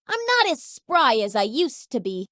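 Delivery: ordinary talk, nothing marked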